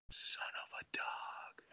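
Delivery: slow, hushed mock-discreet whisper; imitating a polite, drawn-out whisper; voice dipped politely. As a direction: drop right down to a slow whisper, as if too rude to say aloud. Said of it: "dipping his voice to be polite".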